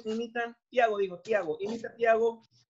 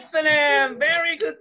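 excited praise; 3 semitones higher, 5 dB louder, slower than usual. Real, not acted. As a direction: celebrate the student's right answer. Teacher cheers a correct answer.

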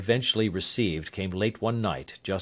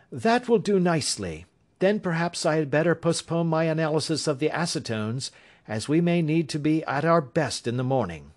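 higher, assured detective voice; Holmes; 7 semitones higher than usual. A narrator's reading. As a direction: Sherlock Holmes reassuring his worried client, higher assured voice.

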